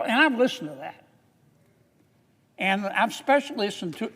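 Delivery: ordinary delivery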